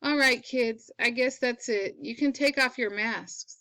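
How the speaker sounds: ordinary delivery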